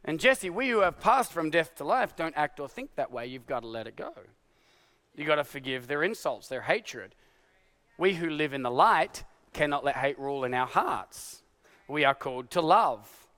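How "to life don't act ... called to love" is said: low, soft, gentle plea; tender exhortation. Acted. Pastor urging the congregation to forgive, soft and low. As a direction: lower and soften, gently urging.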